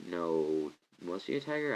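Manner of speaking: ordinary talk, nothing marked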